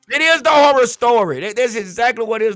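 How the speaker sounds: yelling. Real, not acted. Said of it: "now I'm yelling".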